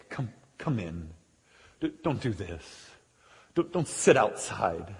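soft, low, halting plea; quiet pleading. Acted. Father begging his angry older son outside, quiet pleading. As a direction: plead gently, hesitating on the first words. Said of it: "begged him".

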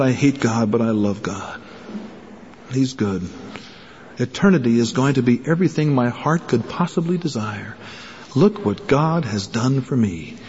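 soft, low, reverent confession; quiet wonder at God; tender confession. Retold or imitated. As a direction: speak it gently as a healed man. Voicing the man's changed heart.

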